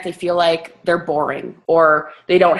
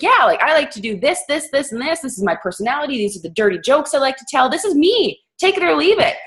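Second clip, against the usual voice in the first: higher-pitched, spirited self-declaration; unapologetic self-introduction; 7 semitones higher, faster than usual. Acted. New coach introduces her own brand, unapologetic. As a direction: proudly lay out who you are.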